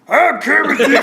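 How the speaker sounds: Kermit the Frog. Shown as a demonstration. Testing if he can do it, rough Kermit voice. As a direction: frog impression breaking into laughter. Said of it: "See if I can do it".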